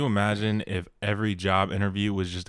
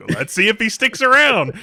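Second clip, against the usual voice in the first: higher, louder hammed-up creepy barber; creepy mock barber; 12 semitones higher, 11 dB louder than usual. Old Robbie eyeing the new guy, in a loud, high creepy voice.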